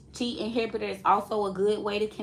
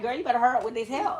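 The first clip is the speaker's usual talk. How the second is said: mock impatient friend; much faster than usual. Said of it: "She just sitting here like".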